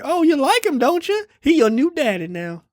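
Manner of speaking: high-pitched mock mama; mother's voice. Mother presents her new man to son, high mock voice.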